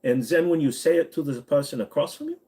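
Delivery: put-on German accent, rising at the end. Shown as a demonstration. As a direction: demonstrate a German accent, lifting the voice high to finish. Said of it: "But the German".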